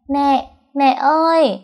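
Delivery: put-on high, slow small child voice; a toddler calling out for mom; little boy calling. A narrator's reading. Small son calling his mother from behind, high and slow.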